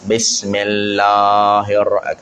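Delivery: "Bismillahirrahmanirrahim" said with besmillah vowels, the Indian way; wrong pronunciation. Shown as a demonstration. Ustaz mimics how some people say besmillah. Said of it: "Ada orang sebut bismillah".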